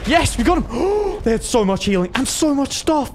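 high-pitched, gasping amazement; excited triumph. Real, not acted. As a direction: celebrate up high, astonished by the loot. Celebrates a kill, sees loot.

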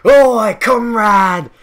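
loud, high rallying cry; hammed-up communist hailing a newcomer; revolutionary comrade. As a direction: shout a drawn-out recruiting call. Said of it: "one man is just like".

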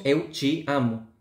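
"Eu te amo" with "te" as "chi", slow Brazilian accent. Shown as a demonstration. Teacher imitating the Brazilian accent, slow "Eu te amo". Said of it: "en el portugués de Brasil decimos".

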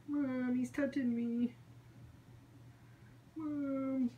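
whiny tattling little sibling. Pretend. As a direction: tattle to Mom, whining and high. Pet voiced tattling to mom.

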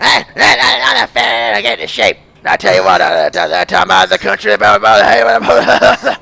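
Waterboy character voice. Retold or imitated. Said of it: "Now you sound like that guy from Waterboy".

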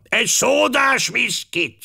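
put-on high, slow old man's order; shouted order. A narrator's reading. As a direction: call the drink order out slowly. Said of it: "kiáltotta". (magas, elnyújtott, kiáltó rendelés)

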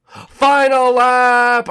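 long, drawn-out high shout; dramatic announcement. Real, not acted. Racer announcing the last lap, dramatic drawn-out shout. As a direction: bellow it slowly like a big announcement.

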